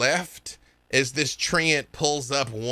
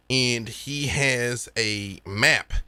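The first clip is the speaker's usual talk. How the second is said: ordinary talk, nothing marked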